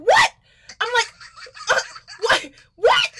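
rising disbelieving what, climbing to a shrieked last one; stunned, it can't be her; shocked what. Retold or imitated. Shocked guest finding out about Michelle Obama.

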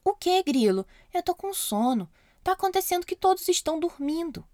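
sleepy puppet. Acted. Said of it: "disse o boneco sonolento".

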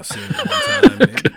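high, loud, laughing through the words; can barely get it out for laughing. Real, not acted. Telling the podcast host a crude story, shrieking with laughter.

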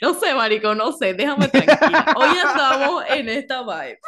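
loud laughing protest. Real, not acted. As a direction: laugh through the words. Giggly protest after her own joke.

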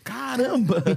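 high exclamation breaking into laughter; amused, high-pitched wow; laughing amazement. Real, not acted. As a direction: exclaim it, then crack up.

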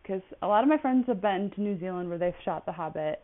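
normal talking voice

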